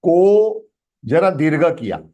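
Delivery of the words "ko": "Ko" held long and a little raised; extended vowel. Shown as a demonstration. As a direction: sustain the o in "Ko". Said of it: "You have extended a bit".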